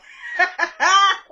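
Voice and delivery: loud, high burst of laughter. Real, not acted. Host cracks up at her own Illuminati joke, high loud laughter.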